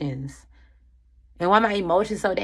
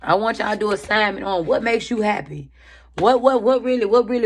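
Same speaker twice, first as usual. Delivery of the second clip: ordinary talk, nothing marked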